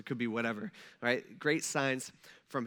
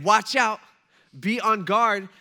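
loud, raised warning; urgent warning; 4 semitones higher, 8 dB louder, slower than usual. Retold or imitated. Jesus cautioning his disciples about deception, loud urgent warning. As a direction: call it out to put them on guard.

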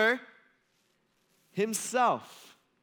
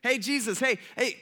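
calling out from crowd; 6 semitones higher than usual. Retold or imitated. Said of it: "this guy shouts out".

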